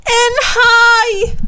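very high, loud alarmed cry; scream. A narrator's reading. Bo spots a shark outside, screaming. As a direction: scream out a sudden danger. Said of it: "skriker han".

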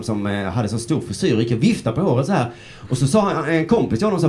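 usual speaking voice

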